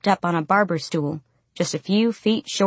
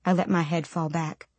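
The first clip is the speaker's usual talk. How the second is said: ordinary delivery, nothing marked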